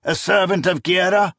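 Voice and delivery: put-on old priest barking. A narrator's reading. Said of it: "he barked".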